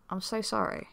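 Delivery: ordinary delivery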